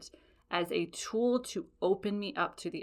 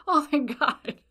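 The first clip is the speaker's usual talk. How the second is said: higher, a bit louder relief; happy sigh of relief; relieved; 7 semitones higher, 5 dB louder than usual. Retold or imitated. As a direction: let the tension go. Relief repeated.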